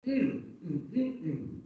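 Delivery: closed-mouth hum tapping out syllables; humming the rhythm. Shown as a demonstration. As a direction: hum four beats in the rhythm of the word. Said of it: "I will hum".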